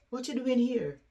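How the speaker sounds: high-pitched surprised question; puzzled, voice lifted well up. Retold or imitated. Father finding his daughter at his door, surprised.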